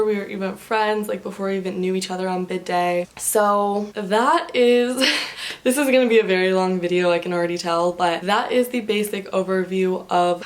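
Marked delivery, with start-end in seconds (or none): 5.0-5.6 breathy laugh. Real, not acted. Laughs at her long video.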